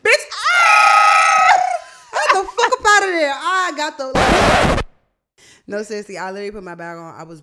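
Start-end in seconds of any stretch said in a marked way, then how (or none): 0.0-4.8 loud high shout breaking into a laugh; excited shout. Real, not acted. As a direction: shout much higher and louder, half laughing. Reliving fleeing the date.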